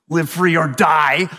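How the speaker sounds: slow, forceful declaration; emphatic motto. Acted. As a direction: proclaim it like a battle cry. Reading out a fierce state motto.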